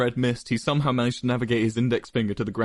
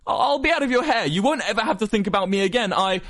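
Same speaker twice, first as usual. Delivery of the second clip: high, loud, cracking plea; desperate; 12 semitones higher, 4 dB louder than usual. A narrator's reading. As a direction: scramble to save face. Wilbur's desperate exit.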